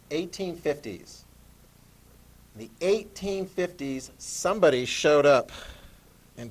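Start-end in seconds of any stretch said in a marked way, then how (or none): 0.1-1.2 slow, each word laid out to copy; slow dictation. Shown as a demonstration. Teacher dictating a date for notes, slow. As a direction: dictate it slowly so the class writes it. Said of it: "Put this down".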